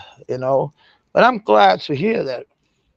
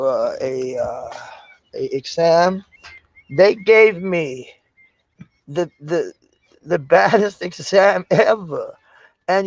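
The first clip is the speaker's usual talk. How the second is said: normal talking voice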